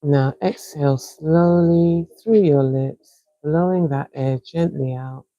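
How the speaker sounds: calm, unhurried breathing coach; soothing breathwork guide with long pauses; guided breathing. Acted. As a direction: count them through each breath gently. Leads a guided breathing exercise.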